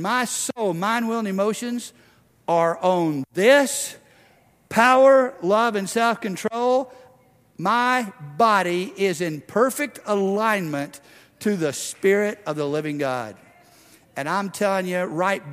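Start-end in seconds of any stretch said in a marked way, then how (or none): 0.0-11.7 preaching climax. Acted. Preacher peaks on power.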